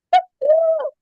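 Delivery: high, loud burst of laughter; amused high-pitched laugh. Real, not acted. Laughs at crazy questions she was asked.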